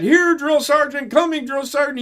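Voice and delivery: high, loud eager recruit shout; mock the clueless private yelling back; mimicking dumb private. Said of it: "he said".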